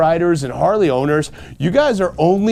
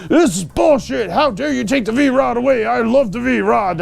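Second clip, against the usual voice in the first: mock outrage; 5 semitones higher than usual. Ranting V-Rod fan mocked.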